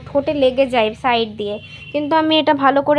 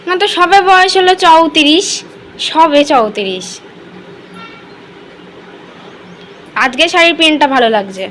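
loud; 3 semitones higher, 8 dB louder than usual. Real, not acted. Family quarrel over a saree.

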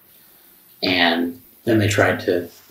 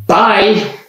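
loud, high mock scream; one high, loud retold shriek; imitated scream; 13 semitones higher, 8 dB louder than usual. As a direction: mimic someone screaming it in your face. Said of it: "she screams in my face".

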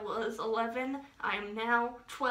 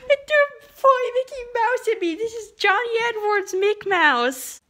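mock sobbing, much higher and louder; hammed-up crying; 12 semitones higher, 11 dB louder than usual. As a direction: wail over the toy like a fake breakdown. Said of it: "I'm gonna break down in tears".

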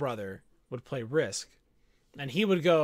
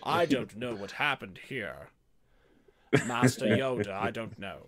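lower, feigned-innocent Sith voice; terrible Palpatine; 7 semitones lower than usual. Retold or imitated. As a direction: play dumb like a scheming chancellor. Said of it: "It's a terrible Palpatine".

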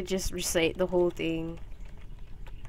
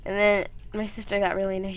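ordinary talk

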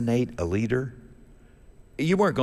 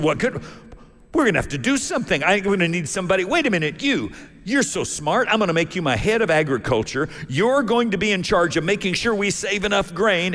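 urgent Pharaoh; 7 semitones higher than usual. Acted. Alarmed Pharaoh makes plans.

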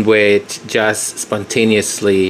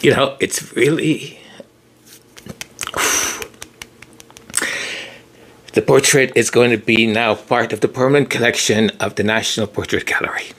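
emotional. Real, not acted. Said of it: "why am I getting emotional?".

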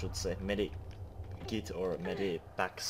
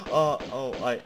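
loud, high startled "oh, oh"; 5 semitones higher, 11 dB louder than usual. Real, not acted. Gamer startled, nearly falling down the hole. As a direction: jolt with a sudden fright.

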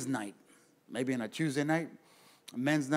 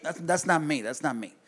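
ordinary talk, nothing marked

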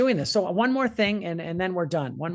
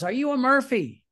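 a raised call down the street, pitched up; 6 semitones higher, 4 dB louder than usual. Retold or imitated. Man on the Irish street hailing the son, calling out. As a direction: call out to a stranger you recognize. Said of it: "this man yells out".

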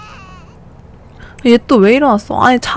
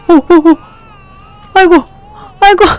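alarmed cries; 8 semitones higher, 5 dB louder than usual. Real, not acted. Sim collapses asleep on floor.

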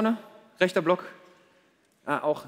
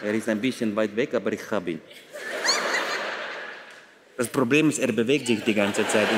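put-on very low Russian-accented sniper reporting; deep, guarded agent with a Russian accent; 9 semitones lower, 4 dB quieter than usual. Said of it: "Da gibt's auch bestimmt ein paar".

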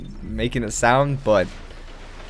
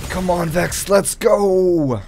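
higher-pitched, rallying call; urging on; 6 semitones higher, 4 dB louder, slower than usual. Real, not acted. Gamer urging the Vex into action, excited rallying. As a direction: cheer your weapon on mid-fight. Cheering on own weapon.

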